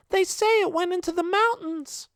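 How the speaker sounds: put-on very high child's voice, called out; little girl yelling back. A little girl answering Daphne from afar, high child's call. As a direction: pitch it up like a small girl shouting back. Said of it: "a little girl yells back".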